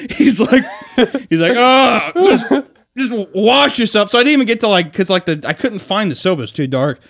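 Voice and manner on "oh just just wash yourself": high, raised groan into a slow order; hammed-up weary frustration; mock exasperated dad. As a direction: imitate a father giving up.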